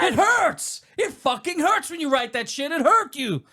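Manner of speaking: very high, wounded yell; pained high protest; acted hurt outburst. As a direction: cry out high, hurt and furious. Acts out angry monologue.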